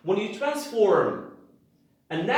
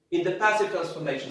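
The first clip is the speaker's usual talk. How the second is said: ordinary delivery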